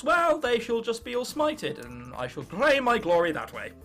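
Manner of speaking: normal talking voice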